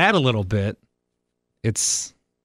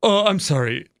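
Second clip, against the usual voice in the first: mock correction; 3 semitones higher than usual. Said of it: "people are always like".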